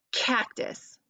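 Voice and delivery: unvoiced "Cactus" with hard k; whispered cactus. Shown as a demonstration. Teacher whispering cactus for kids to hear the k. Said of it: "What do you hear at the beginning".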